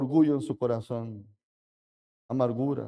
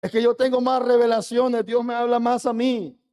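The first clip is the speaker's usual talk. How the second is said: mocking boastful voice; 7 semitones higher, 3 dB louder than usual. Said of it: "El orgulloso dice".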